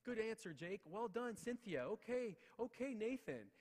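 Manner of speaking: high, loud over-keen teacher; rehearsing teacher praise. Retold or imitated. New teacher practising on an empty classroom, high over-keen praise. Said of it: "going back and forth being like".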